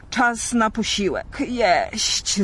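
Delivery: a mild mock roar for food; dragon roar. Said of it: "zaryczał straszliwie". (smok woła o jedzenie, lekko udawany ryk)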